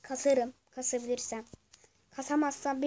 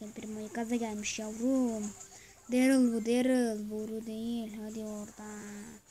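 ordinary talk, nothing marked

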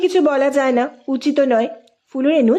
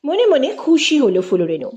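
ordinary delivery, nothing marked

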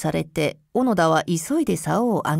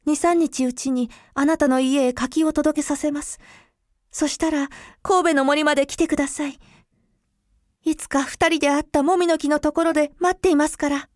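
higher, quick pleading woman; young woman voice; 8 semitones higher than usual. A narrator's reading. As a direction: voice the woman, urgent and hushed. Woman's voice in read story.

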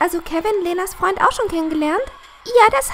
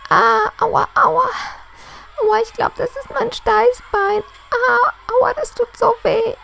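higher, pained whimpering; moaning in pain; 4 semitones higher than usual.

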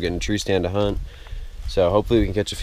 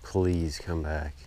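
whispered pleading; 2 semitones lower, 9 dB quieter, much slower than usual. Real, not acted. Pleading again, softer.

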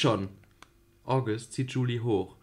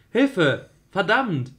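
loud, higher calls for help; panicked cries; 8 semitones higher, 8 dB louder, much slower than usual. Acted. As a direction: scream as your grip slips. Julie dangling, losing grip. (panische Hilferufe)

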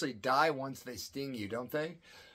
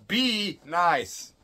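louder, playful telling-off; mock scold; 3 semitones higher, 6 dB louder than usual. Chiding viewers who laugh at him, playful scold. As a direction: wag a finger at the viewers. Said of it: "you that are sadistic".

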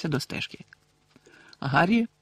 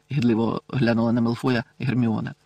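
usual speaking voice

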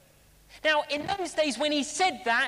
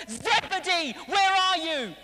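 higher, raised searching call; 4 semitones higher than usual. Acted. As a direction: call out for a lost sheep. Shepherd calls his missing sheep.

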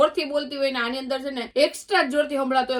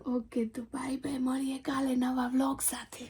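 slow, much softer whispered goodbye; playful whispered farewell, much softer; 8 dB quieter, slower than usual. Shown as a demonstration. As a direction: whisper the sign-off as asked. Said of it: "ધીમેથી બોલવાનું છે".

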